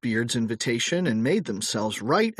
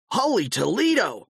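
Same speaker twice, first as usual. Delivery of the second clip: high startled exclamation; surprised cry; 9 semitones higher than usual. A narrator's reading. Old George at the door, startled to see the boys. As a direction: blurt it out in shock. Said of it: "cried old George".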